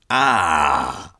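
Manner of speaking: low rasp. A narrator's reading. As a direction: rasp it out, throaty. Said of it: "he rasped".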